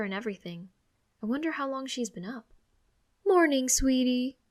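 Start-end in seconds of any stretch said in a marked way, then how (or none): 3.3-4.4 beaming, slow sing-song hello; bright morning greeting. Acted. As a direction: greet your child brightly, a bit louder. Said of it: "still brightly smiling".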